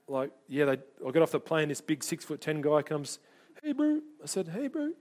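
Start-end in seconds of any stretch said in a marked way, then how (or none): 3.6-4.0 high-pitched Kiwi greeting; Kiwi accent. Retold or imitated. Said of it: "Got my Kiwi accent on". 4.5-4.9 high, softer Kiwi greeting; Kiwi accent. Retold or imitated. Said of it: "Got my Kiwi accent on".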